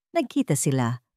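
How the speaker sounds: plain reading voice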